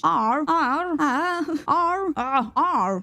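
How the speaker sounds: trying out the letter R several ways; fumbling repeated attempts at saying "R"; attempting English R. Shown as a demonstration. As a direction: experiment with how to pronounce "R", unsure of the English sound. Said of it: "I can't say that in English".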